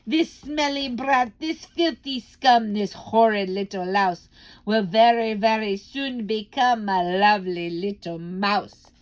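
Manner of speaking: slow, loud, mocking rhyme; gloating shout. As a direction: spit out each insult with relish. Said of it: "she shouted out".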